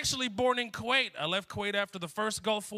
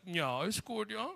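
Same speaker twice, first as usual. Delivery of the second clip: put-on German accent; 7 dB quieter, slower than usual. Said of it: "He's like".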